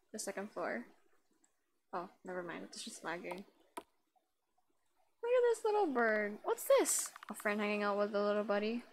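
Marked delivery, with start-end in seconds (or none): 5.2-7.1 character voice. Acted. Voicing the game character.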